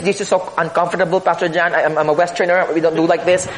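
squirm and brush it off quickly; uneasy Westerner. Pretend. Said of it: "And he's just".

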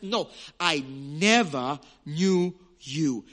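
very slow, deliberate words; slow solemn declaration. Acted. A preacher repeats Jesus's verdict to the self-deceived, slowly. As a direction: let every word land slowly.

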